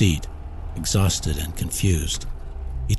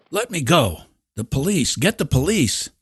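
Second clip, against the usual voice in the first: frantic; 9 semitones higher than usual. A narrator's reading. Ed struggling free of crowd.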